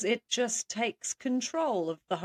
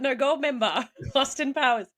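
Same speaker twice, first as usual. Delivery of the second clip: ordinary talk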